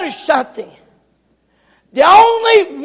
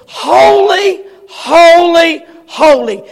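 rising proclaimed cry, louder; crying out holy; 2 semitones higher, 3 dB louder, slower than usual. Acted. Seraph crying to another, rising loud cry. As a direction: call out like an angel in heaven. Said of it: "one cried unto another and said".

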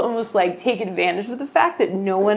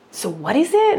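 higher, rising curious question; puzzled, pitched up at the end; retold intrigue; 7 semitones higher than usual. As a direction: imitate a man whose curiosity is caught. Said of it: "he got really intrigued".

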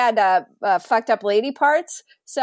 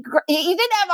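ordinary delivery

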